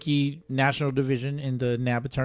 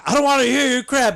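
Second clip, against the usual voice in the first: higher, playfully defiant quote; defiant mock retort; 10 semitones higher, 4 dB louder than usual. Husband replaying his retort to his wife, mock defiant. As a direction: toss it back in mock defiance.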